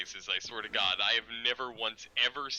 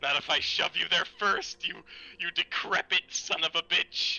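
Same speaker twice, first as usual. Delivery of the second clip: higher-pitched mock outrage; mock indignation; 6 semitones higher, 3 dB louder than usual. Friend threatens to shove older pal into retirement home, mock indignant. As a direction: fire back with playful, pretend anger.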